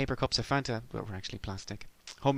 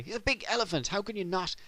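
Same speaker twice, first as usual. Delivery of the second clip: much higher, incredulous; high exasperated Big Bird; 9 semitones higher than usual. Retold or imitated. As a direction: push it up high in disbelief.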